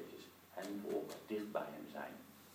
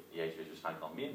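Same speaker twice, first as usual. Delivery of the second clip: usual speaking voice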